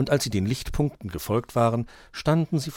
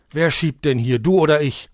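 loud, higher irritated retort; snapping back; 6 semitones higher, 10 dB louder than usual. A narrator's reading. Dominik retorts to Grünäuglein while pushing the sled, snapping. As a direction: snap back, annoyed. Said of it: "fauchte er zurück".

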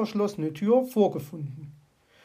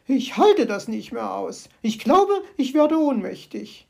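high, louder, hammed-up swoon; faint with fright; 4 semitones higher, 6 dB louder than usual. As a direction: magpie about to faint. Magpie near fainting.

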